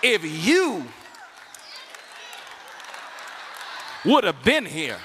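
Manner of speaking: loud, reproachful sister's complaint; sister attitude. Retold or imitated. Martha scolding Jesus for coming too late, with attitude. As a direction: give Jesus some attitude. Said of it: "it's a sister attitude".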